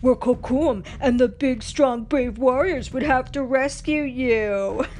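mock warrior voice, loud and slow; Kocoum. Pocahontas teasing her friend, hammed-up warrior impression. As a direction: put on a boastful, booming imitation. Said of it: "in a deep voice like Kocoum".

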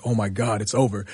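low, softened mock resignation; quiet dismay. Wrestler to himself after a grilling interview, quietly dismayed. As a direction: retell it under your breath, as if defeated.

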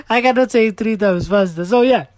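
high, loud outburst after a laugh; loud exasperated admission. Real, not acted. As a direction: raise your voice, owning up to defeat. Exclaims after failing the word.

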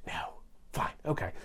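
soft unvoiced shrug "no, fine, okay"; whispered aside. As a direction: whisper it to yourself, resigned. Whispered self-answer.

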